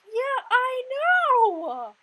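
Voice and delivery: hammed-up drawn-out agreement shout; mock outraged shout of agreement; exaggerated yell. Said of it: "And I'm like".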